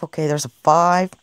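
ordinary talk, nothing marked

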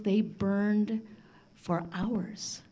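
plain reading voice

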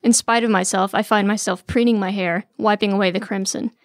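ordinary delivery, nothing marked

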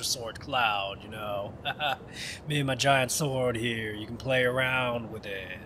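mock flirty. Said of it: "I wanna be a little fuckboy towards Tifa".

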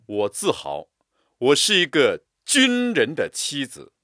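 军人 loud and proud; proud stress on 军人. Shown as a demonstration. Teacher reciting proudly, stress on 军人. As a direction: lift 军人 with pride. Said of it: "为了强调某个词".

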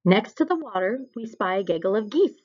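ordinary delivery, nothing marked

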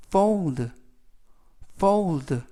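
fold with a full long O and a clear final L-D; careful repeat-after-me read of fold; model pronunciation. Shown as a demonstration. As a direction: say fold clearly so students can copy it. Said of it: "fold라고 발음하시면 되겠고요".

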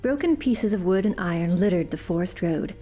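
usual speaking voice

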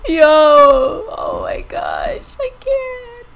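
slow, high crying shout; scared out of wits; frightened shriek. Real, not acted. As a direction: wail in panic. Frightened outburst.

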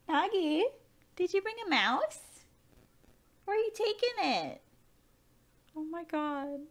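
soft, very high voice; cooing pet talk. Real, not acted. Player asking pet about its mouse, soft high cooing. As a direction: coo it high and softly.

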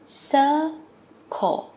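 "Circle" drawn out as two syllables, sir-cle, falling at the end; model word for class. Shown as a demonstration. Teacher repeats the word for children. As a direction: say "Circle" slowly, both syllables clear. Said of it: "Hai âm ha các con".

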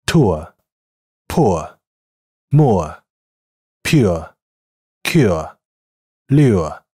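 each word clear and separate, like a teacher; ʊə word drill. Shown as a demonstration. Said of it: "下面我们来练习一下呃在几个单词中的发音". (逐词示范带ʊə的单词)

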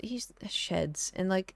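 whisper. Real, not acted. Whispered aside about dog.

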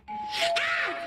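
sudden high shriek of alarm; high startled cry; panicked yelp. Acted. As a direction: yelp in panic when the kid appears. Sees Joey coming, panics.

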